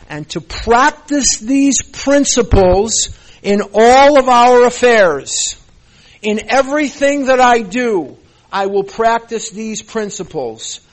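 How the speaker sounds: loud, ringing recitation of the Big Book; loud sermon-style reading of the promises; forceful preaching read. Real, not acted. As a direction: proclaim it to the whole room like a sermon. Loud reading of program text.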